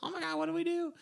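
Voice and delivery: panicked. Retold or imitated. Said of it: "are just like".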